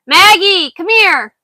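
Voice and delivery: high, loud call to the dog. Real, not acted. Calling the dog Maggie across the yard, loud and high. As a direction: call the dog over from far away. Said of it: "Sorry if I'm yelling".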